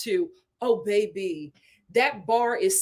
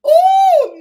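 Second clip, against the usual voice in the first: high, very loud drawn-out ooh; exasperated "ooh"; 14 dB louder than usual. Real, not acted. Host despairing over men being down bad, loud high ooh. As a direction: let out a big dismayed whoop.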